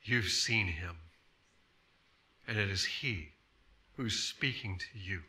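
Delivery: low, soft and tender, as Jesus; quiet fatherly tone. Acted. Jesus speaking to the healed man, quiet and fatherly. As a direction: speak it gently, like a father to a child. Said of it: "in the quiet tone, like a father to a child".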